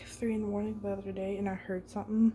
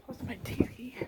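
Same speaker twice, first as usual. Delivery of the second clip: whispering. Real, not acted. Quiet at night in room.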